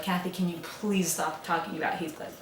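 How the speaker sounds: normal talking voice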